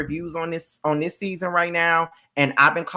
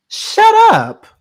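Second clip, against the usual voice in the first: much higher, loud shriek of shut up; shout; 10 dB louder than usual. Real, not acted. Host screaming at Drew through the screen, high and loud. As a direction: scream it, fed up beyond words.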